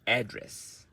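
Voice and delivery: strong AD, weaker dress in address; stress on first syllable. Shown as a demonstration. Teacher gives noun example sentence, address stressed on AD. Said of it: "that is a noun".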